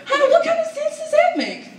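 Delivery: very high-pitched bafflement; hammed-up high indignation; mock incredulous. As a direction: squeal the question in disbelief. Baffled by parents' doll clothes story.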